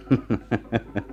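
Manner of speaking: short mocking chuckle; scornful laugh. Laugh after boast.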